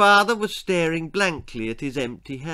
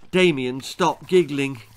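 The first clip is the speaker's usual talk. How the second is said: plain reading voice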